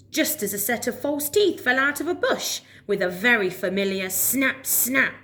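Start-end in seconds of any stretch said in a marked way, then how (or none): none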